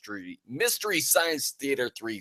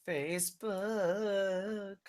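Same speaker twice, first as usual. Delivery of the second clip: very slow, high, softer sing-out; long held playful echo; drawn-out word; 10 semitones higher, 7 dB quieter, much slower than usual. Pretend.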